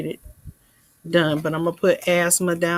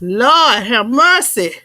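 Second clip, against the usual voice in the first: loud, slow, astonished Lord have mercy; drawn-out stunned exclamation; amazed exclaim; 3 semitones higher, 10 dB louder, slower than usual. Real, not acted. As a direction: let the amazement spill out. Reacting to the clip's list.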